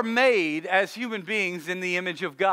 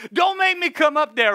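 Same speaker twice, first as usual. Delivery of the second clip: mock threat; 6 semitones higher, 3 dB louder than usual. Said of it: "you wanna say from downstairs".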